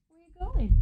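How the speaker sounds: high cooing pet voice. Real, not acted. To her wandering cat, a high cooing baby-talk question. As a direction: ask the cat sweetly where it goes.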